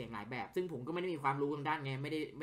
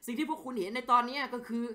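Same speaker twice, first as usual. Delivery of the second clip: acted character dialogue, livelier than his own chat; voicing game characters; 5 semitones higher than usual. Streamer voices game characters talking with a journalist, acted. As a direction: play each character's lines as a scene.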